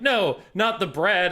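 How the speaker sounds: high, loud and slow, begging; hammed-up victim begging for his bread; mock pleading. Voicing the threatened anime character.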